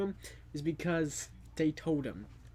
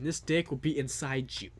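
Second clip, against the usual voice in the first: ordinary talk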